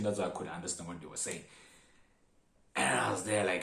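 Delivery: ordinary delivery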